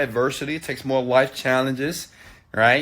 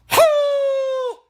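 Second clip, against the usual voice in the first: long, very high dog howl; imitated dog howl. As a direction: howl like a dog in pain, one sustained high note. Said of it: "howling, screaming".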